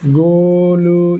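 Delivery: Golu held very long and slow on one level note; long drawn-out call. Shown as a demonstration. Teacher shows the prolonged pluta call. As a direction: stretch the name out as if calling across a field. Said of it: "इस तरीके से".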